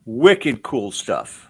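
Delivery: slow, drawn-out Rhode Island wicked cool; attempting the Rhode Island accent slowly. Host tries the local Rhode Island phrase on guests, slow attempt. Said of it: "as they say in Rhode Island".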